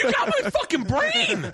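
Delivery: very high, drawn-out squeal through laughter; laughing exclamation. Real, not acted. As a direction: squeal it slowly, cracking up. Cracking up at host's joke.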